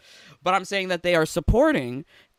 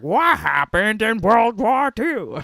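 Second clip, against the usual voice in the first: slow, dopey mock voice; a slow, loud mock voice in a jeering quote; mocking impression; 4 semitones higher, 5 dB louder, slower than usual. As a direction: play him up as a clueless fool, slowly. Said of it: "That's the voice now".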